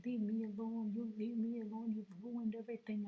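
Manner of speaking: اصرخ بانفعال وانزعاج; upset yelling. Retold or imitated. Retold fight, yelling at husband.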